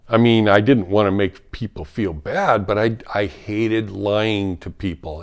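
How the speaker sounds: ordinary talk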